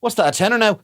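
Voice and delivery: ordinary delivery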